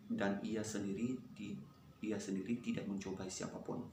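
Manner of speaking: very soft, low murmur; hushed, low read-through to memorize; quiet memorizing recitation. Real, not acted. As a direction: recite it under your breath, low. Murmuring verse while giving memorization time.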